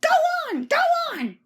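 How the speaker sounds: very high, loud squeaky taunt; silly trucks pushing Thomas downhill; trucks jeering. A narrator's reading. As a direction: egg him on like naughty trucks.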